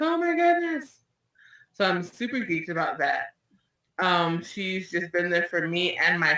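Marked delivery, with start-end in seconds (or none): 0.0-1.0 higher, mock-thrilled "oh my goodness"; excited gasp. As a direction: squeal it as if stunned with joy. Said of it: "I was like".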